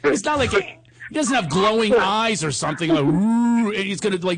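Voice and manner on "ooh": high, drawn-out eerie ooh; imitated ghostly hoot; exaggerated otherworldly wail; mock spooky ooh. Mimicking spooky glowing-eyed kid.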